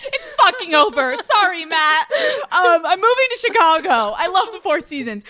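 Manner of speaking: high, hammed-up cry through laughter; laughing mock announcement. Host jokingly declaring engagement over, laughing mock announcement.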